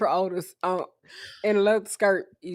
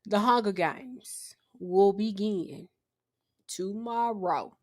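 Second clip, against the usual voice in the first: dramatic announcer; 4 dB quieter, much slower than usual. Pretend.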